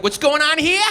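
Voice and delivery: high, loud mock cop; cop arriving. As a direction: burst in like a cop at the door. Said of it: "The cops show up like".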